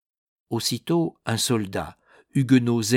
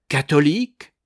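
loud, raised questioning cry; startled, louder question; astonished exclamation; 5 semitones higher, 8 dB louder than usual. A narrator's reading. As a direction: exclaim it in disbelief, rising. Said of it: "s'écria Béville".

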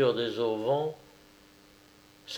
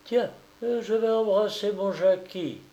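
higher, light motherly eagerness; cheerful impulse; 8 semitones higher than usual. A narrator's reading. Wife hopping out of bed to kiss her child, cheerful impulse. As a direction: announce it lightly, on a happy whim.

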